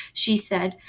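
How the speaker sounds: plain reading voice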